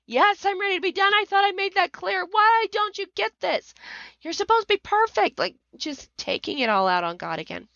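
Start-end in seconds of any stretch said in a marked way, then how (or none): 0.0-1.1 much higher, fast, furious cry; high-pitched frustrated outburst; yelling at God. Retold or imitated. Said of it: "I started yelling". 1.1-5.4 much higher, fast, furious cry; high-pitched frustrated outburst; yelling at God. Retold or imitated. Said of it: "I started yelling".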